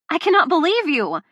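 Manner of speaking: loud, high outburst; exploding. Acted. Delilah erupting at Luca, loud outburst. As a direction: burst it out at him. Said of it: "I explode".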